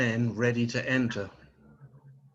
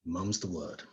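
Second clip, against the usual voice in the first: low, soft deadpan punchline; quiet punchline; 6 semitones lower, 8 dB quieter than usual. Acted. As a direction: drop the voice for the pun.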